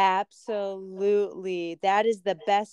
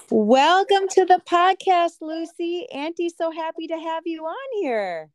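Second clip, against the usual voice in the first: put-on high, sing-song voice for a little girl; talking to a child; 9 semitones higher than usual. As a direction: coo it like an auntie to a child. Aunt greets young niece Lucy.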